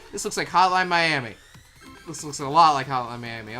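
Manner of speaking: usual speaking voice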